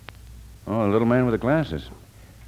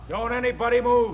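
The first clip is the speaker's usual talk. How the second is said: high-pitched barked order; commanding call. Acted. Police lieutenant ordering everyone at gunpoint to freeze.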